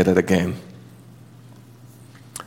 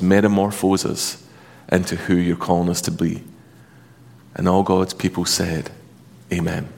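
prayer; 5 semitones lower than usual. Acted. Pastor leads prayer.

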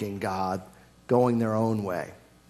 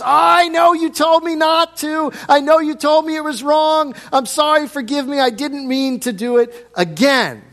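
put-on whiny child; 13 semitones higher, 7 dB louder than usual. Preacher imitating a child pleading sorry to a parent, whiny. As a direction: play the child begging forgiveness.

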